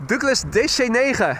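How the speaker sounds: Douglas D C negen in thick Dutch, hard guttural g; heavy Dutch accent. Shown as a demonstration. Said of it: "try saying D C nine in a heavy Dutch accent".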